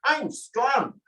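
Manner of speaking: high, mock-tough little boy; little boy bravado. Hansel boasting, a lightly voiced mock-tough boy. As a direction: puff up like a small boy. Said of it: "He acted like this big, strong little boy".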